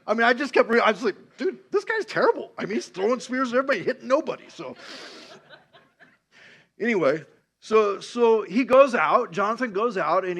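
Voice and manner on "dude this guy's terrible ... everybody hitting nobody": loud, high-pitched amused incredulity; comic disbelief. Retold or imitated. Preacher reacting to Saul's spear throwing, comic disbelief. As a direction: rattle it off high, scoffing at the terrible aim.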